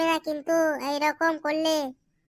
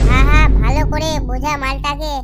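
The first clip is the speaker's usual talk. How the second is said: loud retort; 9 dB louder than usual. Acted. Heated mock quarrel.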